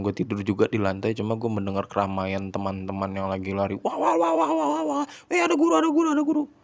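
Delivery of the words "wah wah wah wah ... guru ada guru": high, loud excited classmates shouting; muffled wah wah talk. Retold or imitated. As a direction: shout it like kids warning of a teacher. Friends shouting in school hallway.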